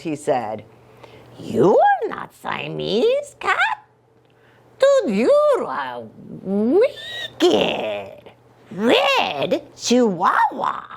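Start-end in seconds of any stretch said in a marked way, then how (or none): 1.4-10.8 high, slow put-on Spanish accent. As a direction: drag out each word in a hammy Spanish accent. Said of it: "very best Spanish accent".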